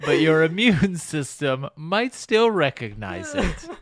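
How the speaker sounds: mock eulogy through laughter; laughing mock eulogy. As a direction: deliver a eulogy while cracking up. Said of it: "funeral speech parody".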